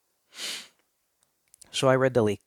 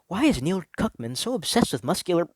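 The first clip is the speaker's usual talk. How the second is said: dream screen text; 7 semitones higher than usual. Acted. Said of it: "I read the words".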